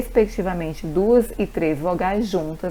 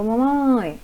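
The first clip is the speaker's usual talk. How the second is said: MAMÃE called out with the last syllable drawn out and higher; calling for mom; 4 semitones higher than usual. Shown as a demonstration. Teacher shows a child asking mom for water, MAMÃE called out long and higher. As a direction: stretch the end of MAMÃE, pitched up like a child calling. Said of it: "um pouco mais forte".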